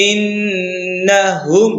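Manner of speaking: ইন্নাহুম read slowly, nasal ন্ন held long; slow Quranic recitation, ghunna held. Shown as a demonstration. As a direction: stretch the doubled ন্ন through the nose, in a recitation tone. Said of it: "নুনে তাসদিদ গুণা করে পড়তে হবে".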